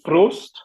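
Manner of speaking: Prost with a German pr and short o; German word Prost. Shown as a demonstration. Au pair listing German words: Prost said German style. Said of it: "no alemão tem a palavra".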